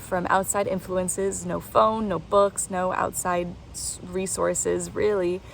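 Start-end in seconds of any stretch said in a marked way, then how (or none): none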